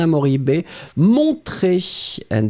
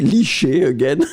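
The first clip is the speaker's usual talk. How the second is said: laughing exasperation; 12 semitones higher than usual. Real, not acted. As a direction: laugh and protest the repeat. Same wrong word given again.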